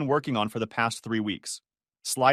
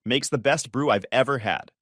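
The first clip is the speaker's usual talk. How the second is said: usual speaking voice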